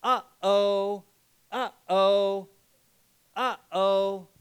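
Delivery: put-on singsong toddler uh-oh; light baby voice uh-oh; baby's uh-oh. Shown as a demonstration. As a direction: imitate a baby chanting. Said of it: "learn to say".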